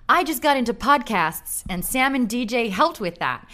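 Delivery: put-on upbeat American accent, fan review read; American accent, bright and enthusiastic. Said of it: "I'm gonna do it in my American accent".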